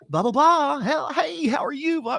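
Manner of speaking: high, loud, over-the-top phone greeting; crazy phone voice. Retold or imitated. Radio host answers the phone with mic left up, high loud showy greeting. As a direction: snap into a high bright phone persona with a shout. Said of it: "Dude, you put on such a crazy".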